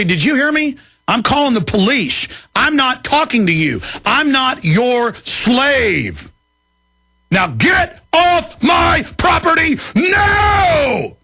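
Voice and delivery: rising, then a slow high bellow; angry order. Retold or imitated. Host retelling how he ran off the census taker, shouting him away.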